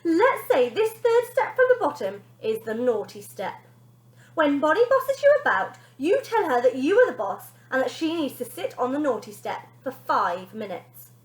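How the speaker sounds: high, loud brisk instruction; nanny teaching mother; instructing nanny. Acted. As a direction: explain like a strict nanny.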